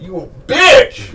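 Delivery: hammed-up Tyler holler; mock Tyler yell. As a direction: ambush shout from behind. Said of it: "be like".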